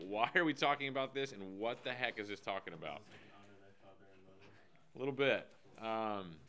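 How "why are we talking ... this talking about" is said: ordinary delivery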